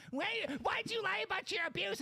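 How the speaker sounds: high nasal whiny mimicry; mocking whiny voice. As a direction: whine it in a mocking falsetto. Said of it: "comes in here with that nasally".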